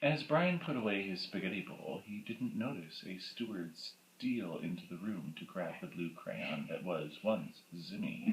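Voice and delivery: low, soft storytelling voice; understated, ominous storyteller; hushed epilogue narration. A narrator's reading. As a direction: read the epilogue low and quiet.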